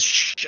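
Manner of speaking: long hushing shh; shushing. Acted. He turns to the kids before the swearing, shushing. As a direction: hush a group of children. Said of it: "and then he says".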